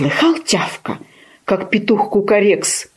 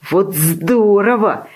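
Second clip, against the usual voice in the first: slow, drawn-out delighted exclamation; joyful puppy bark; 3 semitones higher, slower than usual. A narrator's reading. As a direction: stretch it out with puppyish joy. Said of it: "рявкнул щенок и завилял хвостом от радости".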